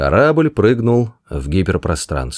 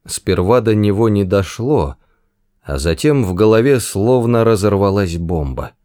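ordinary delivery

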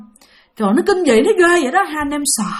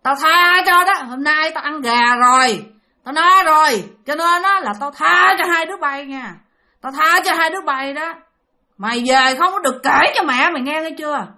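raised, insistent menacing warning; imitated threatening woman; 4 semitones higher than usual. Woman spares children but demands silence, imitated menace. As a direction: warn them as the sinister woman. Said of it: "Cái bà còn dặn thêm một cái câu".

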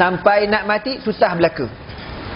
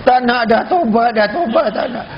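higher-pitched pleading mother; mock swearing off; 6 semitones higher than usual. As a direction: plead as if done with childbirth. Acts mother swearing off childbirth.

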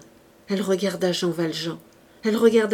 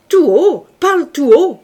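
loud, high command; shouted demand; 8 semitones higher, 13 dB louder than usual. A narrator's reading. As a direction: order him to speak up, full voice. Javert demands he speak up.

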